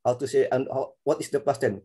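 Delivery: ordinary talk, nothing marked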